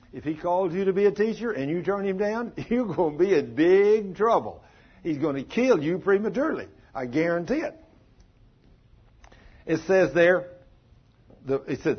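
ordinary talk, nothing marked

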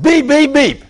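high loud beep beep beep like a car horn; playful horn imitation; mock horn beeps. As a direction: honk it out with your voice.

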